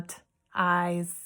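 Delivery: T's split into "t", pause, "eyes"; sounded out. Shown as a demonstration. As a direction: say T's slowly in pieces, "t... eyes". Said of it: "three sounds in the".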